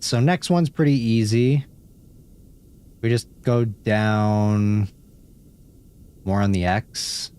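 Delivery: normal talking voice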